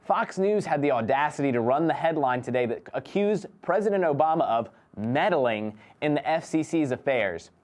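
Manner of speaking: usual speaking voice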